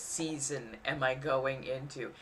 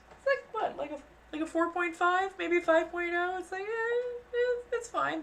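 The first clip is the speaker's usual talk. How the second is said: mock nonchalance; 12 semitones higher than usual. Californian shrugging off quake.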